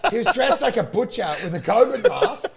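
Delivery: ordinary delivery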